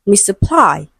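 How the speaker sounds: "misapply" with the weight on -PLY; stress on last syllable. Shown as a demonstration. Teacher confirms the odd one out, "misapply" stressed on -PLY. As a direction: push the final syllable of "misapply". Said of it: "it is stressed on the last syllable".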